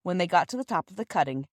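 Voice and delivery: ordinary delivery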